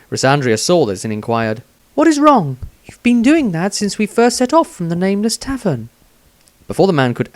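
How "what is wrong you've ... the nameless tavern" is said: higher, lightly voiced young woman asking; a curious female companion, voiced higher; Rysandria's voice. A narrator's reading.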